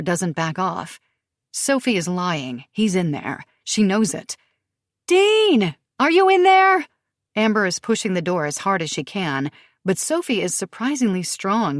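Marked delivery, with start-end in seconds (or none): 5.1-6.9 high loud call into a house. Acted. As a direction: call out to someone inside. Calling into the cabin.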